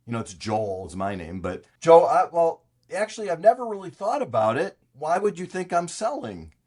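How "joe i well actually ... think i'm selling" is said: lightly voiced puzzled building owner; mildly acted reply to an odd caller; Marv. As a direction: pitch it a bit higher, hesitant then questioning. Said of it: "so Marv would say".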